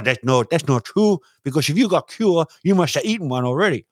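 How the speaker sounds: lightly voiced mock accent; mock Chinese accent. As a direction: poke holes in the claim in a put-on accent. Accent voice rebuts Trump.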